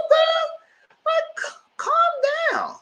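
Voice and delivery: very high, strained shout; exasperated shout. Retold or imitated. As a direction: shriek at her to calm down. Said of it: "I said".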